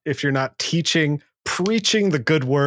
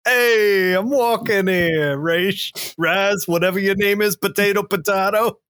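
put-on Brooklyn accent; 5 semitones higher, slower than usual. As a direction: play a cabbie yelling across the street in a Brooklyn accent. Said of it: "I'm also from, like, Brooklyn".

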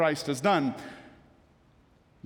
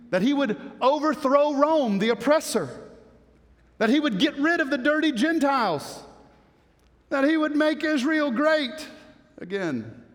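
zealous crowd hopes; 6 semitones higher than usual. Retold or imitated.

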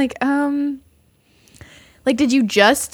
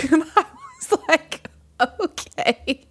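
giggling through the words; laughing; 6 semitones higher, 3 dB quieter, slower than usual. Real, not acted. Laughing while telling a friend about the date.

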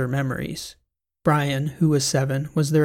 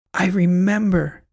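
loud, slow burst of joy, higher; joyful shout; 5 semitones higher, 4 dB louder than usual. A narrator's reading. Woman remembering a song outside the record store, joyful shout. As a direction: cry it out as memory floods back. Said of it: "she shouted".

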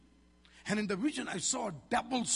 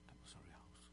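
breathless disbelieving whisper; 24 dB quieter than usual. Retold or imitated. Pastor recalling his doubt about the vision, whispered.

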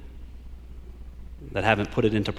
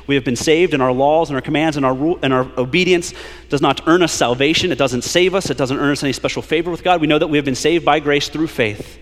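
preaching; 4 semitones higher, 5 dB louder than usual. Acted. Preaching at full force.

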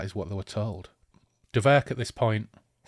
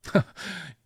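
evil laugh; 10 semitones higher than usual. Acted. Villain laughs at captive.

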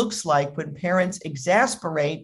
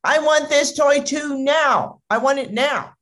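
high-pitched tantrum demand; whining, insistent kid voice; screaming little boy; 8 semitones higher than usual. Acted. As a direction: imitate a bratty child's mock scream. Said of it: "the little boy suddenly starts screaming".

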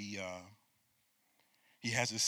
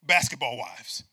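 mocking joke; 5 dB louder, slower than usual. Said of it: "I'm making a joke".

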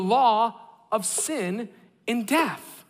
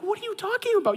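much higher, disbelieving; high mock-incredulous question; 13 semitones higher, faster than usual. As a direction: pitch it up in disbelief. Husband reacts to overpacking.

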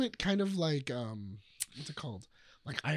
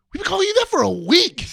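high, loud retold disbelief; incredulous outburst; 12 semitones higher, 12 dB louder than usual. Castmates confronting Meryl Streep over the misunderstanding, incredulous outburst. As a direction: act out a loud, baffled protest.

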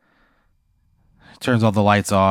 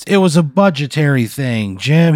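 pleading excuse; 6 semitones higher, 3 dB louder than usual. Acted. Acted principal pleading before attack.

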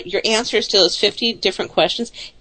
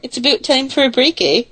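higher, put-on Canadian accent; 5 semitones higher than usual. Shown as a demonstration. Host proving she can do it, Canadian accent. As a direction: show off a Canadian "about" and "eh". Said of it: "I can do the Canadian accent".

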